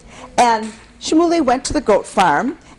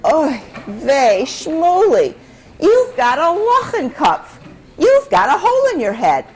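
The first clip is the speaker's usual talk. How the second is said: higher exasperated Yiddish mother; a lightly hammed-up fed-up mother; 5 semitones higher than usual. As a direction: scold him with a sigh of despair. Exasperated mother scolding son.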